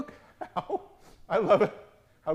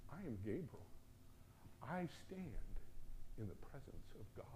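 quiet solemn angel; 15 dB quieter than usual. Retold or imitated. Gabriel rebuking Zechariah, hushed and grave. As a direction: speak as if standing before God. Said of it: "I could just see him say that".

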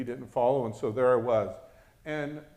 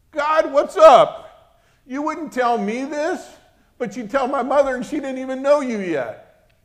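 high, very loud indignant shout; testy complaint; 12 semitones higher, 14 dB louder than usual. Retold or imitated. Preacher challenging God over mother's revelation, loud and testy. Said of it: "I wanted to be a little testy".

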